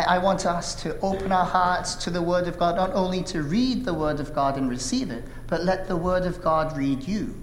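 ordinary talk, nothing marked

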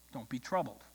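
low, soft retold comfort; gently quoted calm; quiet reassurance. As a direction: settle the congregation with a hushed, falling line. Preacher's repeated reassurance to frightened church.

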